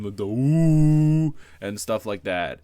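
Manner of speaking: a long, drawn-out, slowed-down mock ooh; teasing ooh. Friends teasing the couple with a slow, drawn-out ooh. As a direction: tease like a rowdy kid egging them on.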